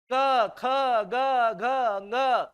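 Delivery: क, ख, ग, घ each released on a full vowel; vowel in every consonant. Shown as a demonstration. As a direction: recite क, ख, ग, घ, ng one by one, vowels held. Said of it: "सब में स्वर बोल रहा हूं".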